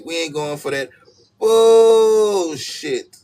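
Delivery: loud, very high stretched bullshit; hammed-up high loud refusal; exaggerated. Shown as a demonstration. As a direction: shriek the last word out long. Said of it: "You gotta say it with the exaggerations".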